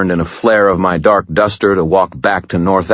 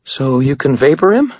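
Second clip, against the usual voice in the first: unsteady voice; 10 semitones higher, 4 dB louder than usual. Acted. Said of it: "her voice unsteady".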